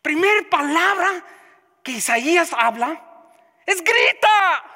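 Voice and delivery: high, loud, slow sermon cadence building line by line; preaching at full force. Acted. Pastor exhorting the congregation, preaching at full force. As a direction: pound out each phrase like a revival preacher.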